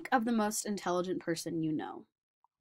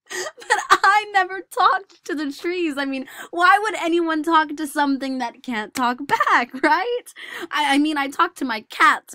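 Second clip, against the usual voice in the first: louder, higher, laughing it off; laughing protest; 9 semitones higher, 11 dB louder than usual. Acted. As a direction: laugh off the taunt. Laughing off the boys' taunt.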